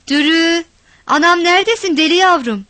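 high, loud call into the dark. A narrator's reading. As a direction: shout for her daughter. Calling into the dark room.

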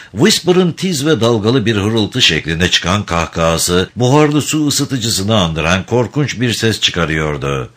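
ordinary talk

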